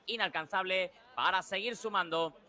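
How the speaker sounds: ordinary talk, nothing marked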